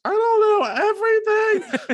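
high, loud overwrought wail; retelling her own teary outburst, high and loud; mock crying self. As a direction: squeal it like you're helplessly sobbing. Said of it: "And I'm like".

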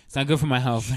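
ordinary talk, nothing marked

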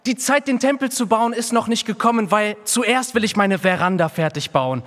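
high, loud mock whiny excuse; mocking the people's excuses. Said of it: "Die haben nicht gesagt".

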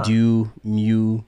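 loud Baltimore vowels on "dew" and "mew"; Baltimore accent. Shown as a demonstration. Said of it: "She has a Baltimore accent?".

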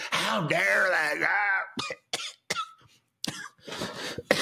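slow, crying mock complaint; mock whiny Joe. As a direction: fake a tearful old man's gripe. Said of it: "he was blaming the other".

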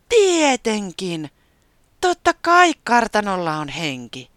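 high, loud thrilled gasp; delighted. A narrator's reading. Taputi thrilled to meet the ghost, high delighted exclamation. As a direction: burst out in delight. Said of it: "se riemastui".

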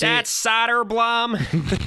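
thick Chicago accent, high, slow and loud. Shown as a demonstration. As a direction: drawl Soderblom like a Chicago sports caller. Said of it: "with a thick Chicago accent".

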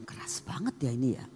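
soft voiceless aside; whispered aside. Retold or imitated. Pastor muttering to herself at the hard stone, whispered. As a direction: murmur it to yourself.

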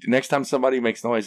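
ordinary talk

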